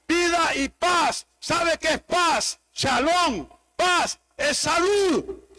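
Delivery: shouted, slowed pulpit proclamation; loud, high revival preaching; fiery preaching. Acted. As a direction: preach it full force, stretching each promise. Preacher builds to full force.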